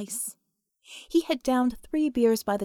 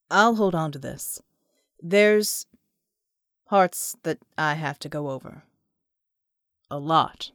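John asks for whiskey, lower voice; John's voice; 6 semitones lower, 3 dB louder than usual. Acted.

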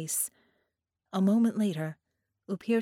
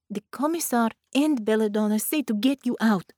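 firm; 3 semitones higher, 6 dB louder than usual. A narrator's reading. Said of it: "Thea said firmly in Russian".

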